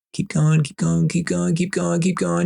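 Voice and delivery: high, rapid-fire chant on one note; urging on. Retold or imitated. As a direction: egg the pourer on in a high, insistent loop. Urging the wine pourer on.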